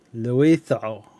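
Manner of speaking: tentative, amused French accent; bad French accent. Shown as a demonstration. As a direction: have a clumsy go at French pronunciation. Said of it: "I'm really bad at a French accent".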